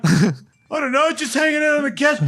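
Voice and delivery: high, innocent-sounding excuse; mock meek husband. Husband excusing himself to raging wife, high mock meekness. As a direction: play the cowed husband answering.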